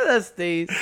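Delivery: cracking up while saying it; laughing. Real, not acted. Cracks up at the phrase.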